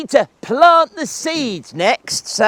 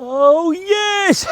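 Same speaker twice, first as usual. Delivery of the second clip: normal talking voice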